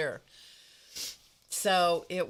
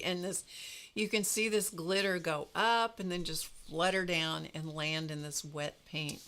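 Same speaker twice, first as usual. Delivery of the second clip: ordinary talk, nothing marked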